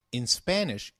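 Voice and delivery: normal talking voice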